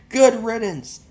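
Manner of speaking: put-on bully sneering at the boy's boat; mocking bully. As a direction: laugh while you insult it.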